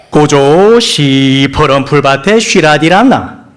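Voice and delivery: drawn-out Pyongan dialect psalm reading; 평안도 사투리로 느리고 크게. Acted. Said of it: "평안도 버전입니다".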